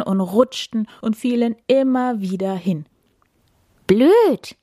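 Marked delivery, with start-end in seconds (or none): 3.9-4.6 high, loud squirrel squeal. A narrator's reading. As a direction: squeak it out crossly. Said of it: "quiekte das Eichhörnchen".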